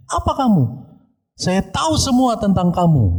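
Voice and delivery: high, taunting possessed voice; orang kerasukan setan. Retold or imitated. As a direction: challenge them high-pitched, like the demon accusing. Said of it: "dia bilang seperti itu". (menuduh dengan nada tinggi seperti kerasukan)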